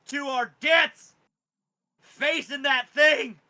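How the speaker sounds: loud, high shouted warning, dragging the middle slow; wounded father warning. Acted. Crippled father warning his son off the dragon, shouting high. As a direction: plead with him to let it go, at full volume. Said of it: "and he goes".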